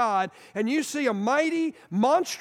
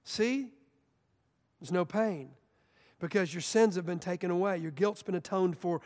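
softer, lower, tender reassurance; gentle angel; 5 semitones lower, 5 dB quieter than usual. Acted. Angel comforting the terrified Isaiah, soft and tender. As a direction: soothe him like a kind angel. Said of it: "And then the angel says".

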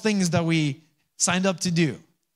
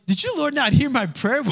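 high-pitched comic protest to God; mock frustration; 10 semitones higher than usual. Preacher replaying his own prayer when things got worse, mock frustration. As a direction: pitch it way up, a hammed-up complaint in prayer.

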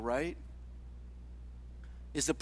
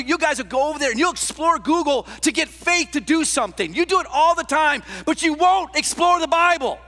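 loud, high, driving sermon voice; preaching at full force; 7 semitones higher, 8 dB louder than usual. Real, not acted. Preacher scolding those who won't explore the Bible, full-force preaching. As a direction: press it hard on the congregation, loud and high.

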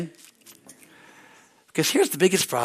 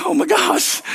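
excited shout; 14 semitones higher, 5 dB louder, slower than usual. Retold or imitated. His shocked reaction retold.

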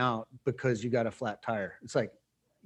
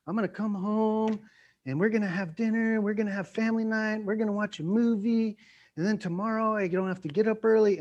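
higher-pitched eager listing of plans; happy anticipation; 8 semitones higher than usual. Acted. Person picturing family night at home, eager anticipation. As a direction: hold the pitch high, rattling off hopeful plans. Imagining a happy planned evening.

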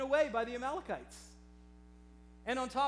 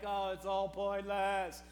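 mock despair; slower than usual. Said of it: "experienced some very deep depressions".

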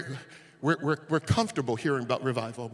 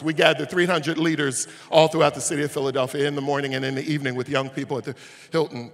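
ordinary talk

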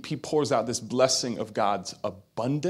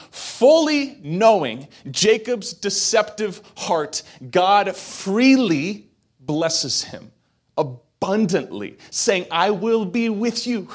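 high, loud, punched preaching build; fiery sermon build; preaching at full force; 9 semitones higher, 7 dB louder than usual. Real, not acted. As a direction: hammer each name like a pulpit climax. Preacher proclaims God's blessing.

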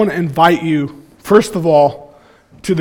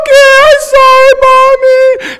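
put-on very high, loud tearful little-girl voice; toddler apology; 9 dB louder than usual. Father voicing his daughter apologizing in the car, high loud tearful voice. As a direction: whimper it out like a sorry toddler. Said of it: "She's like".

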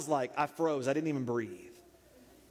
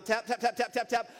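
high, rapid-fire tapping imitation; double-time taps; 7 semitones higher than usual. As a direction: rattle off taps like scurrying nails. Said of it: "began a double time".

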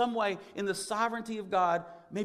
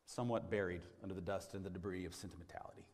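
whispered; 10 semitones lower, 10 dB quieter, faster than usual. Real, not acted. Sermon aside dropped to whisper.

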